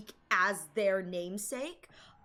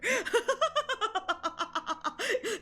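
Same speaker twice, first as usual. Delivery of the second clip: long, high-pitched laughing fit; real laugh pealing out high; giddy laughter; 12 semitones higher than usual. Real, not acted. Fan catching own favourite-character slip, giddy laughter.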